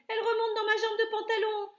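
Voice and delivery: high, loud, panicked cook voice; frantic shout. A narrator's reading. Cook swatting at his trouser leg, high loud panic. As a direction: threaten the mouse in a panic. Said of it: "cria l'homme".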